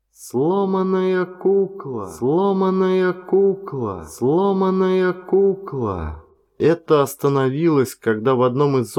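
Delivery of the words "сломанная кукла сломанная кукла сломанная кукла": slow haunting repeated whisper-like chant; echoing in his head. A narrator's reading. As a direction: drag each word out like an echo. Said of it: "в голове звенели её слова".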